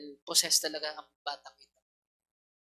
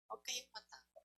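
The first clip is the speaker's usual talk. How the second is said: quieter reassurance; 3 semitones higher, 14 dB quieter than usual. Real, not acted. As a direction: say it again almost under the breath. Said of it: "repeated softer".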